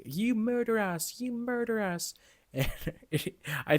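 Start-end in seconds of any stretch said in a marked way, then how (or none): 0.0-2.1 mock yell. Drugged girl yelling at the Capitol, high mock yell. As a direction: shriek it up high but lightly, as a quote. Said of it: "she just starts yelling".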